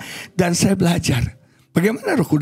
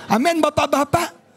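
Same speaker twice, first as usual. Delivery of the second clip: ordinary talk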